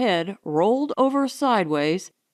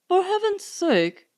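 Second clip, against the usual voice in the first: calling out; 9 semitones higher than usual. Acted. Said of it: "he called".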